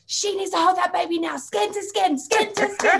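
high, insistent mock demand; kicking off. Mother mimics partner demanding skin to skin, kicking off. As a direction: imitate a worked-up new dad, pitched right up. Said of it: "he was kicking off".

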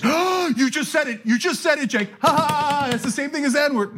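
high-pitched mock gasping outrage; feigned outrage. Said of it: "when people feign outrage".

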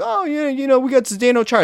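a hint of a boastful Boston fan; mock Bruins fan. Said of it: "The Bruins fans are like".

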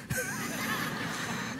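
a breathy burst of real laughter. Real, not acted. Preacher laughing at her own story to the congregation, real laugh. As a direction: laugh at your own joke.

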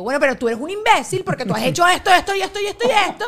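loud, high, fast exploding rant; angry outburst. Retold or imitated. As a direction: blow up and vomit every grievance at once. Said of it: "hasta que llega el día que te digo".

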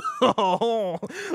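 high hammed-up French chuckle; exaggerated Gallic laugh. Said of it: "That's my French laugh right there".

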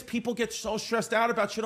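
ordinary talk, nothing marked

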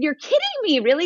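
high-pitched mock astonishment; retold disbelief. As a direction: act out surprise, pitch leaping up. Retelling her disbelief at his news.

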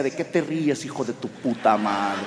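a low, slow inner voice hinting at rage; the dwarf in my brain mutters a threat; angry little voice. Retold or imitated. Inner dwarf to the laughing Spaniard, low menace. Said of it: "Una vocecita que decía".

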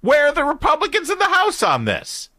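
very high-pitched indignant outcry; high, incredulous challenge; exasperated demand. Real, not acted. Host rants at his own party.

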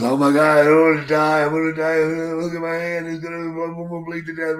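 mock panic over his own blood; panicked freakout.

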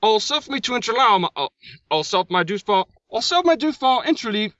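put-on high-pitched Monty Python-style English accent; hammed-up British accent, fumbling the line; fake English accent. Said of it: "let me give this another shot".

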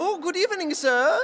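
high, loud mock German greeting; German Willy Wonka. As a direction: greet grandly in a German accent. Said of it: "like, a German Willy Wonka".

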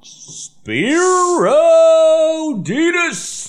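high, drawn-out ring-announcer call; announcer intro. Pretend. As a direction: boom the name like a fight announcer. Dramatic announcer name reveal.

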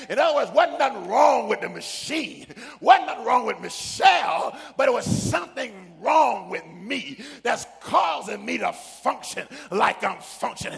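loud, rising sermon cadence; preaching at full force. Acted. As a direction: build it up and preach to the crowd.

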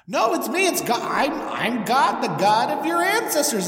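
high, eager self-introduction; God. Acted. Bush introducing itself to a doubting Moses, high and eager. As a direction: announce yourself excitedly, pitched well up. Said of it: "He's like".

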